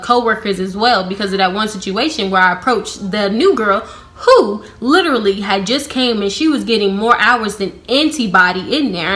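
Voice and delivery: ordinary talk, nothing marked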